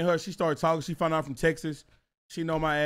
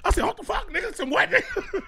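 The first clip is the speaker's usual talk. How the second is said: high voice breaking into laughter; laughing disbelief; 9 semitones higher, 5 dB louder than usual. Real, not acted. Retelling his shock at the nickname, laughing disbelief. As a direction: blurt it through real laughs.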